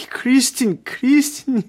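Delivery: high, anguished name-calling; desperate calling. A narrator's reading. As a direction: call out to a lost beloved. Raoul calls out desperately for Christine.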